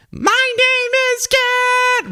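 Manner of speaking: very high, drawn-out holler. Acted. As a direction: hold each word long, way up high. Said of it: "[name] is like".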